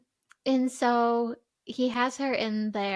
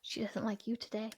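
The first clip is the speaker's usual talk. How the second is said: teasing whispered aside; 12 dB quieter than usual. Real, not acted. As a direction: whisper it like a secret. Teasing aside during Alexa attempt.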